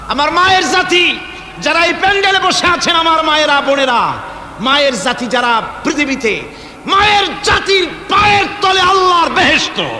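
high, loud rallying sermon delivery; full-force preaching. Real, not acted. Preacher honouring the mothers in the pandal, full-force preaching. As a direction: build to a ringing shout for the crowd.

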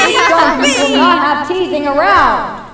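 very loud announcer shout; loudspeaker cry. A narrator's reading. Loudspeaker scolding a teasing crowd, loud cry. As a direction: cry it out over the fair speakers. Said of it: "cried the loudspeaker".

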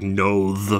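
"nose" ending in a buzzing, lispy Z. Shown as a demonstration. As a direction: voice the final S in "nose" like Z. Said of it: "Like when you see N O Z E".

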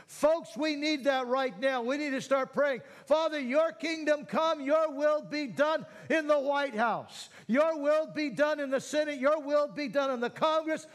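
high, loud prayer building to a near shout; rally the whole church to pray; rousing preaching. Acted. Pastor leading the church in prayer for the nation, rousing.

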